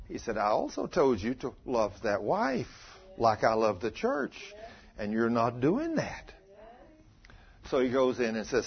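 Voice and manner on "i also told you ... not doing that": softer, lower, measured reproof; slow down and lower it, a quiet telling-off; calm correction. Retold or imitated. Preacher voicing the Lord correcting husband, calm correction.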